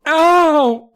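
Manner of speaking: loud, high cry of pain; hammed-up "ow" wail. Child with a trapped hand, high wail. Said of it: "uttered a high, heartbreaking wail".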